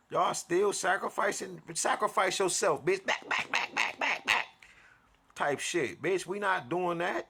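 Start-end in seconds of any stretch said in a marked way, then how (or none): 3.0-4.5 airy, high hissed backs; mock whispered warding off. Comic pushing away sacrifice, whispered back back back. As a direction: shoo it away in a breathy panic.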